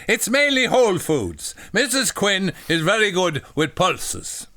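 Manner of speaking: high, put-on Irish accent. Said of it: "They're Irish".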